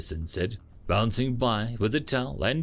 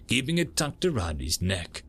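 usual speaking voice

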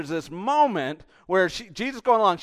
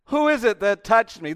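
loud, higher-pitched demand; calling out; 7 semitones higher, 8 dB louder than usual. Acted. Jesus asking the crowd who touched him, loud call. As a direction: call the question out to a whole crowd.

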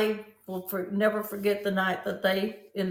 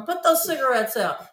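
raised, higher preachy scolding; hammed-up lecture to a smoker, louder; mock scolding preacher; 6 semitones higher, 3 dB louder than usual. [name] lecturing smokers outside the mall, a mock preachy scold.

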